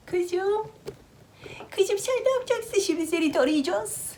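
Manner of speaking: high, sing-song pet talk. Real, not acted. Baby talk to the cat. (kediye yüksek perdeden bebek sesi)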